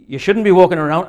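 attempted Scottish accent. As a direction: have a poor go at a Scottish accent. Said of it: "I can't do a Scottish accent".

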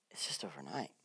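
soft hushed whisper. Real, not acted. Preacher to grieving listeners, a reassuring whisper. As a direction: breathe it like a secret comfort. Tender aside on mourning.

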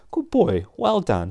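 high-pitched, delighted praise; praising the dog. Real, not acted. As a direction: praise a dog in a lifted, encouraging voice. Praising her dog Stixie.

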